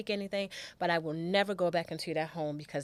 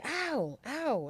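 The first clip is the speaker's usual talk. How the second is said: imitating child's ow; 7 semitones higher than usual. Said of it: "she would just be".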